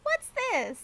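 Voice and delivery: high, bright surprised question; mock surprise. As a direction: catch them having fun. Aunt Daisy voiced.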